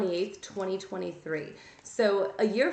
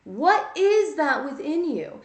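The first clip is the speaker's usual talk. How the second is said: loud slow demand; 7 semitones higher, 7 dB louder, slower than usual. Real, not acted. Challenging the listener.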